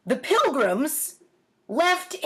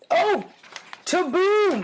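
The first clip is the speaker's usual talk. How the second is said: mock explosion; 8 semitones higher, slower than usual. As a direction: burst out like a cannon going off. Imitating the gunshot in drawing.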